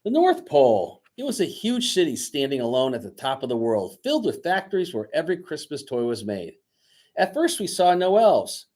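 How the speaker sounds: lively read-aloud storyteller voice; story narration. A narrator's reading. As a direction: read the story to the class with energy. Teacher reads story aloud.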